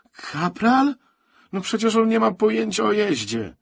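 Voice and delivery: high, loud disbelieving outburst; astonished, high-pitched objection; incredulous. A narrator's reading. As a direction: burst out in disbelief, voice leaping up. Disbelief at who is driving.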